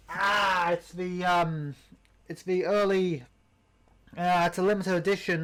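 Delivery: normal talking voice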